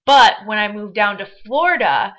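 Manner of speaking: ordinary talk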